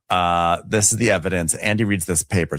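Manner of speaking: usual speaking voice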